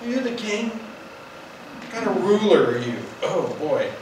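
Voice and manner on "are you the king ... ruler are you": higher, slow scornful taunt; disdainful wife. Retold or imitated. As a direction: sneer the questions down at him. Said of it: "I can just hear the disdain in her voice".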